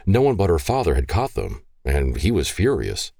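usual speaking voice

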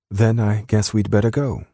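high-pitched British woman; British accent, lifted well above narration. A narrator's reading. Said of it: "in her soft British accent".

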